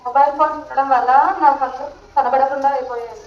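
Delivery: low, weak voice of an injured woman; mock-suffering teacher moaning about her eyes; acted pained complaint. Madam acts hurt by phone use.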